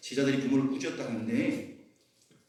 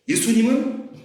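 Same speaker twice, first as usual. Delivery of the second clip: usual speaking voice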